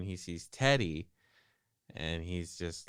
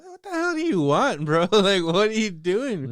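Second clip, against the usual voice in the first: very high, loud pleading demand; exasperated yell; 13 semitones higher, 10 dB louder than usual. Acted. Victim begging to know what the killer wants, high exasperated yell. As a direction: yell it way up high in comic disbelief.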